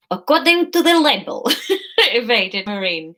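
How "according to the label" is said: high, hammed-up Spanish accent; attempted Spanish accent. Courier evading a question, put-on Spanish accent.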